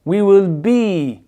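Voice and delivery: slow "We will be", "be" dragged out; long I. Shown as a demonstration. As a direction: let the vowel of "be" trail long. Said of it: "Ça traîne".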